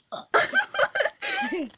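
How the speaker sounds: shrieking giggle; high squealing laugh. Real, not acted. Laughs at burp.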